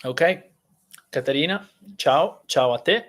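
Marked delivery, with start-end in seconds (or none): none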